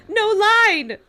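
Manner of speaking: high, loud laughing shout; crying out. Acted. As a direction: yell it in horror. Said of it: "she cried".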